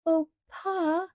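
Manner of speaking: put-on high childish plea; a little girl's last plea to her father; pleading. A narrator's reading. Laura pleads with Pa before crying, high plea. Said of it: "Laura pleaded".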